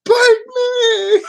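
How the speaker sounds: very high, loud hammed-up shout; mock yelling. Streamer shouting at a fleeing cat, mock yell. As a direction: yell it at the cat in pretend desperation. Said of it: "I'm yelling at a cat".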